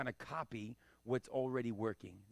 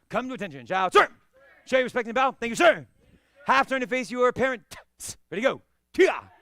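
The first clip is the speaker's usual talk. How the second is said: loud, high ceremonial drill calls; class commands; 10 semitones higher, 15 dB louder than usual. Real, not acted. Instructor leading students to bow out of class. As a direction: call the bow like a dojo instructor.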